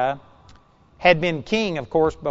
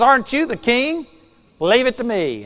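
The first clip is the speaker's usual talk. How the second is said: higher, taunting wife voice; scornful queen; 7 semitones higher than usual. Retold or imitated. Queen scolding her sulking husband, higher and taunting. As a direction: needle him, then take charge.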